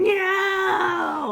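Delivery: put-on child's wailing no, high and loud; crying no. Boy at the scary movie, crying shout. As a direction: wail it like a scared little boy. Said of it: "standing there going".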